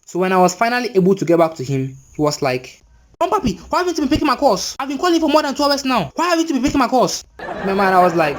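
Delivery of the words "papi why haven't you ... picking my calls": high, impatient mimicked scolding; mock phone yelling. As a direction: imitate an aggrieved friend nagging on the phone. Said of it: "yelling at me on the phone".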